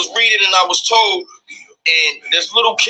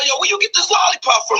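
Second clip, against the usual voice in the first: mock cop questioning; 9 semitones higher, faster than usual. Police questioning the mother.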